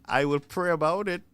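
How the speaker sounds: normal talking voice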